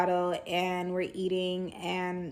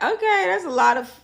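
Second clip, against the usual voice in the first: high, louder startled reply; taken aback; 11 semitones higher, 11 dB louder than usual. Retold or imitated. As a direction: react like that was way too much information. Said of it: "I'm like".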